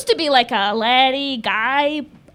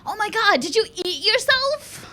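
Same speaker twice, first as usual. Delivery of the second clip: shocked friend; 8 semitones higher than usual. Retold or imitated. High school friend reacting to the weight loss, mock shocked. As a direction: mimic a scandalized friend, pitch climbing high.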